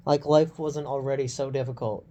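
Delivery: normal talking voice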